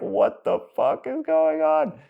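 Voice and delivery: very high, slow, drawn-out disbelief; exaggerated high-pitched freak-out; mock bewilderment. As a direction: squeak it out, utterly baffled. Acting his freaked-out bewildered self.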